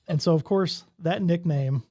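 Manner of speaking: normal talking voice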